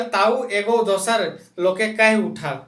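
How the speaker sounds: ordinary talk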